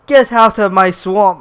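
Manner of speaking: loud, slow hammed-up Shrek shout; booming, drawn-out mock roar; mock ogre bellow. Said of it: "fucking Shrek-ass looking beast comes up".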